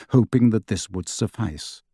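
usual speaking voice

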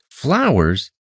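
high, outraged disbelief; incredulous shout. A narrator's reading. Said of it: "Iridan shouted".

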